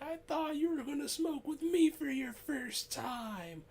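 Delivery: very high, loud hurt whine; mock sad. Friend left out of the first smoke, high mock-sad wail. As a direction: imitate a wounded friend in squeaky falsetto. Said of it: "he put on this sad face".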